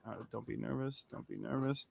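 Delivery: very hushed self-soothing whisper. Retold or imitated. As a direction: re-enact whispering nervously into the mic. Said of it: "I'm whispering into the mic".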